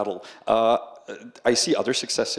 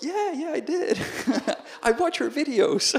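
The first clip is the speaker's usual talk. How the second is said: high, laughing mock-tourist voice; giddy fan. Tourist fan admitting he met the scammer, giddy. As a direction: imitate a delighted fan, pitched way up through laughter.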